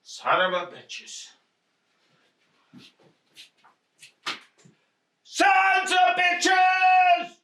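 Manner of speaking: slow furious shout of sons of bitches; angry swearing. Acted. Husband to wife over the robbery, furious shout. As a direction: bellow the curse out, drawn long.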